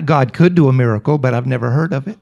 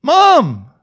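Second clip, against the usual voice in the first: "Mom!" yelled high and loud; a daughter shouting "Mom!" across the house, high and loud; shouted; 10 semitones higher, 4 dB louder than usual. Retold or imitated. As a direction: call her mother at full voice. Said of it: "and shouted".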